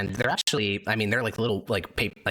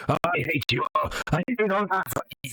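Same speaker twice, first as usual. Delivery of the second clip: a cartoon villain puppet ranting; 9 semitones higher than usual. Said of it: "he says".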